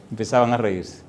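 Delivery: ordinary delivery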